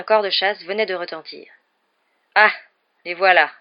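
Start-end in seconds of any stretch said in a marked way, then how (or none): 2.3-3.5 loud, mildly bitter announcement. A narrator's reading. Ghost host seeing his rivals arrive, mildly bitter. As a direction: announce them with a touch of bitterness. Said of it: "dit Nick d'un ton amer".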